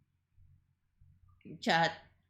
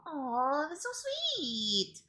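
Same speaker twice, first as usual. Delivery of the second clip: cooing; 6 semitones higher, 4 dB quieter, much slower than usual. Real, not acted. Cooing at chat's babying.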